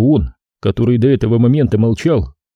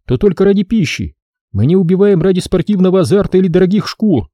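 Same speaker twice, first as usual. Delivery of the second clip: indignant, much higher; forced out in disbelief; outraged girl; 8 semitones higher than usual. Acted. Girl outraged at the brother's insult. Drenna continues.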